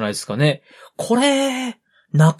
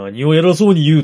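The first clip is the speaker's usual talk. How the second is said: louder, haughty noble voice; mock haughty lord; 5 dB louder, faster than usual. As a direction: play a pompous lord. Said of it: "何を偉そうにと思いました".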